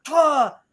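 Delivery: sharp high mock yelp, as if struck on the shoulder; yelp of pain. Man whacked with a staff yelps in pain. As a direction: cry out high and sudden.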